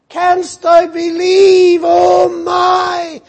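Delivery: high, loud cry with a sob in it; broken shout. Acted. The father's broken cry over his unbelief, shouted and tearful. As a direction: wail it out like a broken man. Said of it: "He's broken because he knows".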